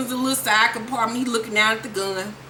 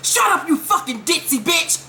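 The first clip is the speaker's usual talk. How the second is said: higher, mock furious shout; mock angry snap; 6 semitones higher than usual. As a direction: snap at mom, pitched up. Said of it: "So he's like".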